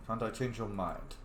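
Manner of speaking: ordinary talk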